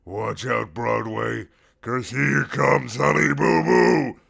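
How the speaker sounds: slow, loud triumphant shout. Acted. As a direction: announce it to Broadway. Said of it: "Alana shouted out".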